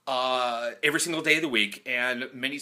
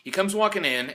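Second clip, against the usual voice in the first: normal talking voice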